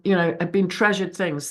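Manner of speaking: ordinary talk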